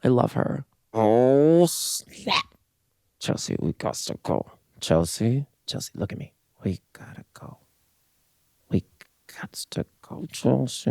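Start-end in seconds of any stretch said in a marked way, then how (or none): 0.9-2.4 drawn-out rise, then hushed snap; playful catchphrase imitation. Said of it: "Pretend you're [name]". 3.2-10.9 hushed, urgent sitcom whisper; mock-dramatic, very quiet role-play. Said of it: "Pretend you're [name]".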